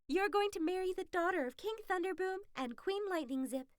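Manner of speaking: high, hurried, thrilled fox voice; rushing Kuzma along with big news; excited fox. Acted. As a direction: fox urging him to hurry.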